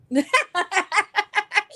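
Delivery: long, high, loud laugh; real peal of high laughter. Real, not acted. Cracking up at the friend's Snortapalooza joke, laughing.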